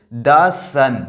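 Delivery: "द सन" with a short "da"; the as "da" before sun. Shown as a demonstration. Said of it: "इसलिए इसको हम दा pronounce करेंगे". ("द सन" में द को छोटा "दा" बोलें)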